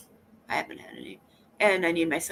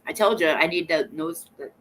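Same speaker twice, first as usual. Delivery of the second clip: ordinary delivery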